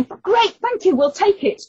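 high, loud, over-eager acceptance; retold loud high eager reply; eager blurt. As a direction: grab at the offer with a loud high yes. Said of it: "I hear my voice going".

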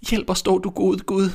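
put-on desperate girl's prayer; little girl pleading. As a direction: beg God as a frightened little girl. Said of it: "sagde hun".

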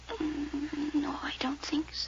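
soft hushed denial; the girl quietly denying she knows Pauline; evasive whisper. Acted. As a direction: whisper the lie, avoiding the question. Girl evasively denying knowing actress.

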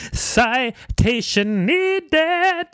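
very high, drawn-out call; mock interjection. Host cutting into a Wikipedia reading, mock high-pitched objection.

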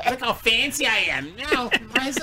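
prissy lady voice. Retold or imitated. Voicing the mother.

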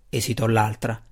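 usual speaking voice

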